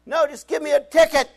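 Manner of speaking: high and loud insistence; insistent plea. Retold or imitated. Driver begs the officer for a ticket, high and loud. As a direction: demand the ticket.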